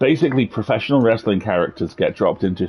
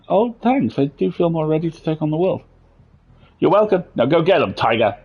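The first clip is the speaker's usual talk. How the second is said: character voice; 5 semitones higher than usual. Acted. Voicing the pep-talk character.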